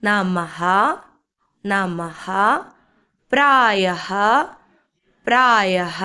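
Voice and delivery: നമഹ and പ്രായഹ measured, each ending in a clear "ha"; model reading. Shown as a demonstration. Teacher re-reading words for learners, നമഹ and പ്രായഹ carefully sounded. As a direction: read നമഹ, പ്രായഹ out for repetition. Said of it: "ഇനി നമുക്ക് ഒന്നുകൂടി വായിച്ചു നോക്കാം".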